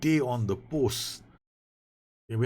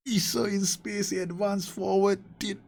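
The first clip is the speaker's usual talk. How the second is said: high, thrilled play-by-play; excited commentary; 12 semitones higher than usual. Real, not acted. Gamer recapping his team's goal, thrilled.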